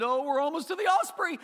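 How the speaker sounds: high and loud, hammed-up childlike thrill; mock excitement. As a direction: gush over the osprey in mock delight. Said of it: "get all excited".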